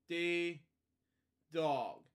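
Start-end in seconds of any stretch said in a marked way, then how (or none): none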